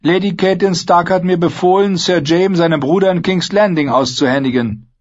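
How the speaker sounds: hohe, laute Kriegerinnenstimme; Brienne, entschlossen. Acted. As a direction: mit fester Stimme einen Auftrag verkünden. Brienne spricht weiter. (Brienne erklärt Vargo Hoth ihren Auftrag, hoch und laut)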